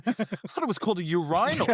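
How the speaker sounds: a stranger half-shouting in mild mock confusion; mock baffled passerby. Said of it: "someone walking past the bathroom, going".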